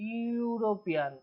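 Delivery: ইউরোপিয়ান said slowly so the ইউ opening stands out; slow down on ইউরোপিয়ান to show its ইউ start; slow pronunciation. Shown as a demonstration. Teacher showing students the word's opening sound, slowly. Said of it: "sound আসছে".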